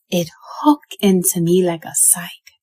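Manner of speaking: usual speaking voice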